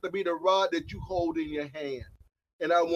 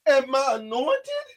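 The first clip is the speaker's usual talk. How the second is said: impassioned sermon voice; preaching at full force; 6 semitones higher than usual. Acted. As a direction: thunder it at the congregation like a pulpit preacher.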